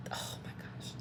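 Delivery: awed breathless whisper; a hushed oh my gosh. Real, not acted. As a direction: breathe it out in wonder. Awed aside mid-sentence.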